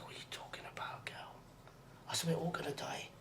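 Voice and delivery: soft whispered reassurance, a little quick; hushed whisper. Retold or imitated. Friend whispers back to a frightened girl, hushed. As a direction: whisper it gently so only she hears.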